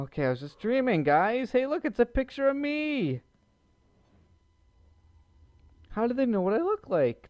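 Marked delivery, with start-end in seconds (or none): 1.5-7.3 surprised, higher. Real, not acted. Finds his own picture.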